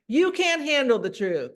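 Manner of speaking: loud, high, forceful outburst; direct voice. Shown as a demonstration. Presenter demonstrating control of the conversation, direct voice. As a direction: hit it hard like a courtroom showdown. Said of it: "You have to use that direct voice".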